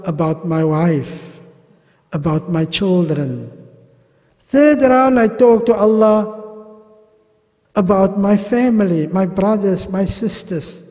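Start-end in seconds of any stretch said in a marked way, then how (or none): none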